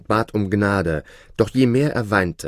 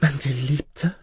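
high, startled woman's voice; shocked cry; 7 semitones higher than usual. A narrator's reading. Sorceress hearing her silent lover speak, high shocked cry. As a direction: call out in stunned disbelief, pitched well up. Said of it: "rief sie entsetzt".

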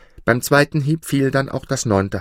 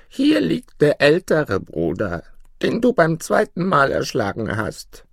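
put-on old forest woman; 7 semitones higher than usual. A narrator's reading. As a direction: draw it out high as the crone. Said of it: "und die Alte sagte".

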